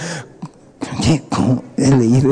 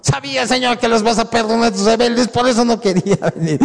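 grumbling Jonah; 6 semitones higher than usual. Acted. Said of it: "Jonás era de un corazón así".

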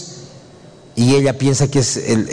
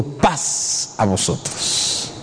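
very soft, slow, near-whispered blessing; low, hushed, drawn-out peace greeting; 5 semitones lower, 7 dB quieter, much slower than usual. Acted. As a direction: breathe out the greeting slowly and softly. Voices Jesus greeting the disciples.

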